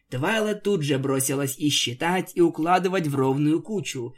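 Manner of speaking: ordinary delivery, nothing marked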